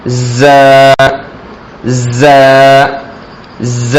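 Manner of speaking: ز buzzed through closed teeth; teeth together. Shown as a demonstration. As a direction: clench and buzz ز. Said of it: "دونوں دانتوں کو ملا کر زور دیا".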